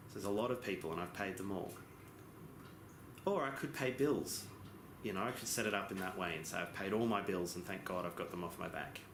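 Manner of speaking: ordinary delivery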